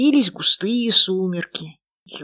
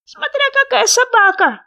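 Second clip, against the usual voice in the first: high, loud, squeaky little frog; small creature's high, loud voice; 7 dB louder than usual. A narrator's reading. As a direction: pipe up eagerly as a tiny frog. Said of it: "говорил невидимый кто-то".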